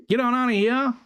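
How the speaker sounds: high yelled call from porch. Retold or imitated. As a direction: holler it like family on the porch. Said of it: "always yelled it from the porch".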